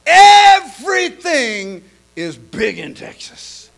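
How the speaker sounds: loud, slow, drawn-out tagline; big punchline. Acted. Preacher to congregation, slow loud punchline.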